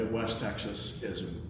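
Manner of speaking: ordinary talk, nothing marked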